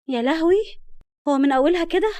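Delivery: high, loud exclamation; dismayed surprise. A narrator's reading. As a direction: react with comic dismay to bad news. New doctor hears of harsh director.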